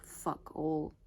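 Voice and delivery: usual speaking voice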